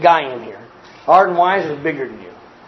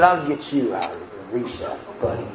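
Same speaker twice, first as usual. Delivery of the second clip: bully's threat; 11 semitones lower, 8 dB quieter than usual. Retold or imitated. Big bully threatening the small boy, low menace.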